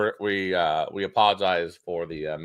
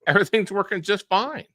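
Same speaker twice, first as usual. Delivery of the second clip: sarcastic high voice; 12 semitones higher than usual. Acted. Sarcastic denial of software problems.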